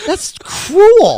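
very high, loud protest; appalled amusement. Real, not acted. Host judging the yearbook joke, high loud amused protest. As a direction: cry out in scandalized disbelief.

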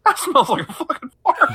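high, giggly mock disgust; laughing disgust. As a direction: laugh it out while complaining. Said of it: "being like".

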